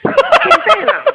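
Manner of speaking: very high, loud squeal; incredulous outburst. Real, not acted. Storyteller marvels at the silent man, squealing incredulously. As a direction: burst out in high-pitched disbelief.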